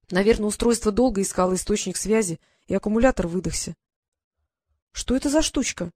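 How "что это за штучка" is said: higher, curious wondering; puzzled, lifted voice; surprised question. A narrator's reading. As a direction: ask in mild amazement. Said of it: "удивлённо спросила Диана".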